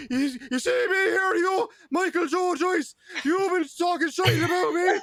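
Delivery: high, slow shouted call-out voice; Irish Traveler call-out. Retold or imitated. [name] imitating a Traveler's call-out video, high slow shouting. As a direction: yell it like a Traveler calling someone out. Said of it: "they all sound the same".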